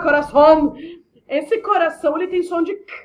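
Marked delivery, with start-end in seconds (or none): 0.0-0.7 Spanish accent. Shown as a demonstration. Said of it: "tô falando em espanhol".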